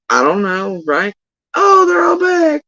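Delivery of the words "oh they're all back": mock panicked cry.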